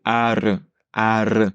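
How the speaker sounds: "Ar" with an exaggerated rolled r. Shown as a demonstration. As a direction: trill the r in "ar", exaggerated. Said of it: "Essaye d'exagérer le mouvement".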